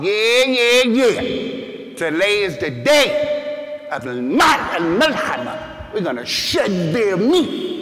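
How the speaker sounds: high, loud yelling of yeah, yeah, then a slow chant; rowdy cheering shout. Retold or imitated. Companions all joining in for battle, loud rowdy shout. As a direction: whip up the crowd with a roar.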